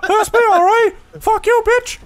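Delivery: squeaky mock insult, laughing; high laughing taunt. Laughing trash talk, high voice.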